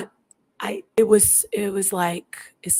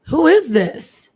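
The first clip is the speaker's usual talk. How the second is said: louder, high and slow, insisting; retold frustrated demand; 7 semitones higher, 5 dB louder, slower than usual. Repeating the question to the unknown caller, frustrated. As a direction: demand an answer, scared and frustrated. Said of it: "I was getting frustrated".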